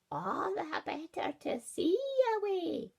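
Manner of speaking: high, slow greedy granny voice; wolf slavering at the girl in granny voice. Acted. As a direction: drool over the words like a hungry wolf. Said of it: "slavered the wolf".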